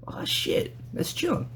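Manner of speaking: a shocked whisper to yourself; whispered inner thought. Retold or imitated. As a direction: whisper it, high on the name. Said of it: "where you're just kinda like".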